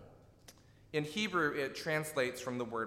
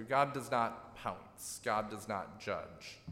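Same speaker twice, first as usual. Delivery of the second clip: slow emphasis; 3 semitones lower, slower than usual. Real, not acted. Preacher stressing God's restraint.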